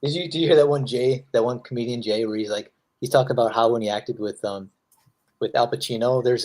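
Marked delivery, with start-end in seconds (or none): none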